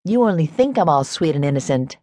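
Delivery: ordinary delivery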